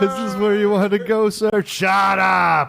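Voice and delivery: put-on high, slurring drunk robot; boozy machine voice, snapping at the end. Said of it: "That's my drunk robot impression".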